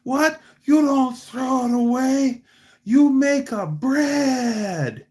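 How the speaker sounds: high, loud mock outrage in a Sicilian accent; mad Sicilian old man. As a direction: exclaim indignantly, dragging out the last words. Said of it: "he gets all mad".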